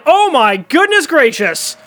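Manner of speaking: high, loud, thrilled call; excited home run call. Acted. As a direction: shout it out as the ball flies.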